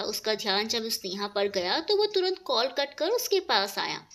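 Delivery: ordinary delivery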